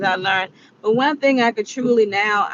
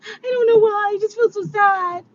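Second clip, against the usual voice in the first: high, tearful whimpering voice; crying sister; 13 semitones higher than usual. Retold or imitated. Vlogger imitating her younger sister crying on her birthday, high and tearful. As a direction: imitate a sister weeping for no reason. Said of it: "she was just crying".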